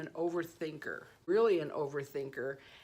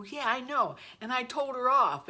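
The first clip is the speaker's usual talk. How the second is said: proud retort; 6 semitones higher, 5 dB louder than usual. Retold or imitated. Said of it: "I go".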